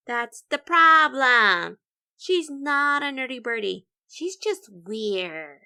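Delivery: loud, slow scornful verdict; loud mock disdain; loud dismissive. Nerdy birdies dismissing Vulture.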